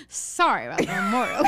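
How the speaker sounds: hammed-up detective with a cigar in his mouth; mock old-timey gumshoe. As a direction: talk round a big cigar, laughing. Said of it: "like a big cigar in his mouth".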